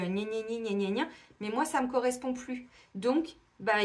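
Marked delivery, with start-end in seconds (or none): 0.0-1.1 mock the gossips' yammering; mocking gna-gna. Reader mimicking gossip that people keep feeding, mocking. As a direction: mimic petty chatter in a dismissive drone. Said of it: "des gens qui ont besoin d'entretenir tout ça".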